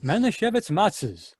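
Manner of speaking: ordinary talk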